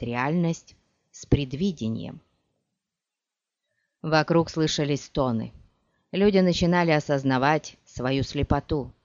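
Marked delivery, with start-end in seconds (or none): none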